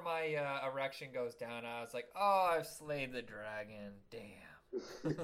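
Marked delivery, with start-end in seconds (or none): 2.1-5.1 slow, half-whispered mock boast; mock awe. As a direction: breathe it out slowly, mock-triumphant. Acted quote in a crude joke.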